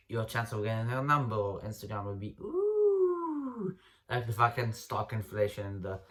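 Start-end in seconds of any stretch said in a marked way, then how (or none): 2.4-3.8 very high squeaky ooh rising off the chart; a sky-high ooh like prices exploding; soaring ooh. Acted.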